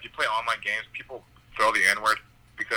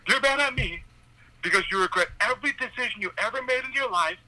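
high mocking rant with a burst of laughter; retold yelling rant; 14 semitones higher, 3 dB louder than usual. Yelling at the old driver, high mocking rant. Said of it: "I was, like, yelling".

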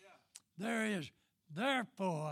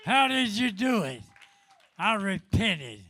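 preaching; 4 semitones higher, 6 dB louder than usual. Acted. Preacher shouting testimony.

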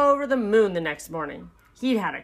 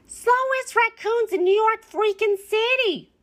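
high-pitched character yell; bossy raccoon hollering, pitched way up; 4 dB louder than usual. A narrator's reading. Beasley berates his two slow workers, yelling. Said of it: "he'd yell".

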